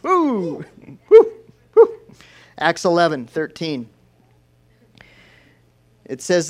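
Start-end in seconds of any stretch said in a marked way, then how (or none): 0.0-2.0 high, loud cheering; excited whoops. Real, not acted. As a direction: whoop for joy. Preacher cheers for the podcast.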